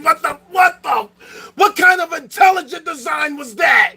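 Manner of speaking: yelling furiously up at the sky, voice high; angry shout. Real, not acted. Ranting at the great simulators about bed bugs, furious shout.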